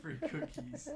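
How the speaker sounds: squeaky mock voice. Joking about free train snacks.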